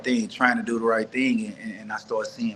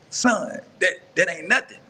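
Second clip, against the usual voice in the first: imitating his dad's voice pitched much higher; a retold father sounding unlike himself, higher; dad's odd new voice; 8 semitones higher than usual. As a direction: put on Dad's strange, high-pitched tone. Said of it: "Why are you talking like that?".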